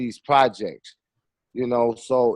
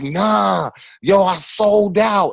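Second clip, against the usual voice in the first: higher, slow, put-on street seller voice; retold bootlegger; 6 semitones higher, much slower than usual. Bootleg seller telling the filmmaker it sold out, slow and higher. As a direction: draw it out like the seller bragging. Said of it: "He was like".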